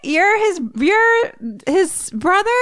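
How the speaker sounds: very high, halting incredulous squeal; mock astonishment. Everyone learns Skip is the brother, squealing in disbelief. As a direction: imitate a stunned crowd, squeaking in disbelief. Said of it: "they're all like".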